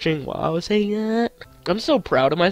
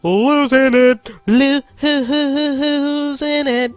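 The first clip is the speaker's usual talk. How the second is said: higher, stretched and wobbling; mock meltdown; 8 semitones higher, slower than usual. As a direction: ham up losing his mind. Exaggerated meltdown.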